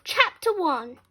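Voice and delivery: ordinary delivery, nothing marked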